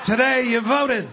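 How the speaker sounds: punchline. Acted. Devil springing the trap on the governor, slow loud punchline. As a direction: land the joke with a slow, loud, high finish.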